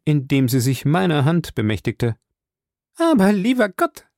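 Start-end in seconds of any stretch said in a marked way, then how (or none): 3.0-4.1 high, flustered chatter; a scatterbrained lady babbling on; chattering Mrs Baker. A narrator's reading. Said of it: "schwatzte sie weiter".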